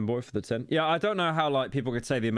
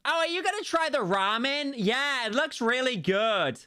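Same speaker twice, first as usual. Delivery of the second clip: put-on very high, squealing American tourist accent; exaggerated American accent, pitched sky-high; mock loud American. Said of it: "Americans are so loud".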